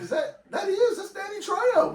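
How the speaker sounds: squeaky high, laughing surprise; like spotting a star on screen; amazed recognition. Retold or imitated. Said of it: "I was like".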